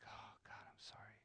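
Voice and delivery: barely audible whispered apology; remorseful whisper. Retold or imitated. Preacher recalls apologizing to God, ashamed whisper. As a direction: whisper it, head hung in shame.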